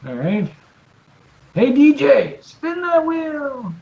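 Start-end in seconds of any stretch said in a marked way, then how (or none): 1.5-3.7 high, drawn-out showman call; game show host. Pretend. Host cues the prize wheel.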